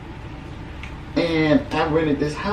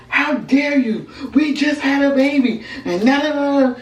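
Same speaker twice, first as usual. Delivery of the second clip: outraged yelling; 10 semitones higher than usual. Retold or imitated. Wife yelling at her children's father, high outrage. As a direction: let the betrayal ring out.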